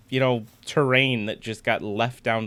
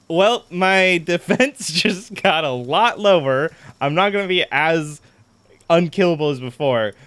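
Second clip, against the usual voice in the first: angry laugh; 3 semitones higher, 6 dB louder than usual. Real, not acted. Gamer laughing bitterly at his weakened armor, louder. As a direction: laugh through real annoyance. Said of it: "I am so mad right now".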